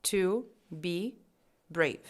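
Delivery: very slow, separated words. Shown as a demonstration. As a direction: read each word apart, slowly. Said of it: "Então tá escrito assim".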